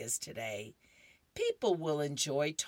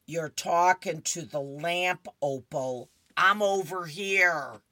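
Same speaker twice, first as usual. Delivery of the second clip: louder, slow exasperated husband; Earl voice; 3 semitones higher, 8 dB louder than usual. Acted. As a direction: point it out patiently. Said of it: "Earl goes".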